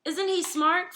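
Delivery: put-on proud kid voice; excited child. A narrator's reading. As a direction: show off the bird, thrilled. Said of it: "Fudge asked".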